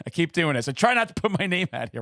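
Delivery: normal talking voice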